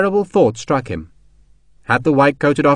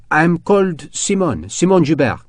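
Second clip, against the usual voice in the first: put-on French accent; 4 semitones higher than usual. French woman introduces herself.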